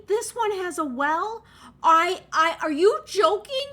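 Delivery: high, loud, delighted outburst; excited disbelief. Real, not acted. Streamer thrilled that the house has a well, loud and high. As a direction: shout it like you can't believe your luck.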